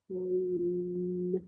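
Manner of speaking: "Coin" held long and level on the final n; stretched sounds. Shown as a demonstration. Teacher drawing attention to next sound, "Coin" stretched. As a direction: hum out the n in "Coin". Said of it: "Can you hear the next sound?".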